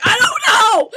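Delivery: high, loud exasperated yell; mock panicked shout. Student stuck on absurd survey question, mock desperate shout. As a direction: wail it, half laughing.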